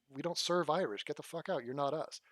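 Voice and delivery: ordinary talk, nothing marked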